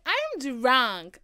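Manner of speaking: loud, high imitation of herself drunk; drunk exclamation. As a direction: announce it like a happy drunk. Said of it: "That's how you know I'm drunk".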